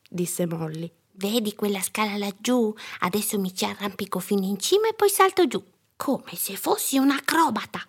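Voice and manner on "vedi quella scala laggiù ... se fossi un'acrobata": put-on high, excited child voice; young daredevil announcing a stunt; boastful little girl. A narrator's reading. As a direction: show off like an eager kid, rising in pitch. Girl boasts of climbing plan.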